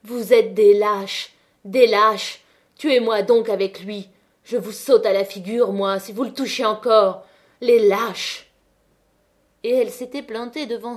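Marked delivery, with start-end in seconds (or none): 0.0-8.5 furious shout. Acted. Catherine shouts at the crowd to defend her man, furious. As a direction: hurl the words at them.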